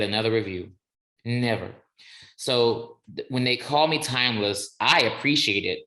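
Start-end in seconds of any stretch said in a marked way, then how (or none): none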